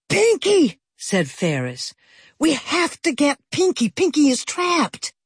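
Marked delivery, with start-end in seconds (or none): 0.1-0.8 high, urgent call of the sister's name; alarmed cry. A narrator's reading. Girl calling urgently for her trapped sister, high alarmed cry. 2.4-5.1 high, urgent, pleading; panicked. A narrator's reading. Girl begging the adults to rescue her sister, high panic.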